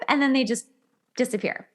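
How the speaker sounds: normal talking voice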